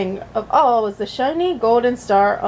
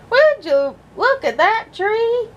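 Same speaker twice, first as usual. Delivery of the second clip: put-on slight Southern accent; 11 semitones higher, slower than usual. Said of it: "suddenly slightly southern".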